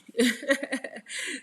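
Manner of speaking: short, high burst of laughter; quick breathy chuckle; amused laugh. Real, not acted. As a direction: laugh loudly at your own remark. Laughs at her own remark.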